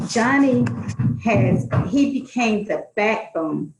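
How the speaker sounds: ordinary talk, nothing marked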